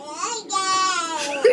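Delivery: high burst of real laughter; laughing. Real, not acted. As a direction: crack up helplessly. Cracking up at the story.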